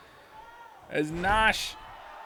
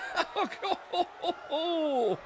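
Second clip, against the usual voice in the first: breathless laugh; 10 semitones higher, 5 dB quieter than usual. Real, not acted. Laughs at his own excitement.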